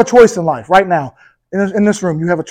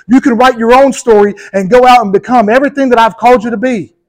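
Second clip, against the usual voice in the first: raised, full-force preaching as God speaking; preaching God's voice; 4 semitones higher, 3 dB louder than usual. Acted. Preacher to congregation, voicing God, raised and fervent. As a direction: proclaim it as the Father's promise.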